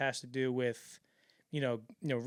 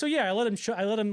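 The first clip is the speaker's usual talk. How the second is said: smug voiced Cameron; 7 semitones higher, 6 dB louder than usual. Cameron voiced explaining prank.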